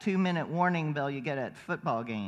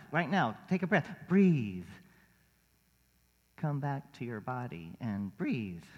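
guided breathing; 4 semitones lower than usual. Acted. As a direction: ease them into stillness and breath. Leads the congregation in breathing.

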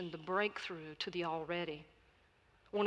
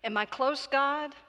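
louder pleading question to God; 7 semitones higher, 8 dB louder than usual. A narrator's reading. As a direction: implore God, voice lifted. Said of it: "pleaded the pilgrim".

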